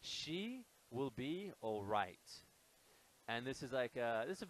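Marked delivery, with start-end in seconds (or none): 0.0-2.2 "She will be all right" spelt out slowly, every word separate; slow teacher's model of "She will be all right"; slow demonstration. Shown as a demonstration. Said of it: "colloquial expression would be".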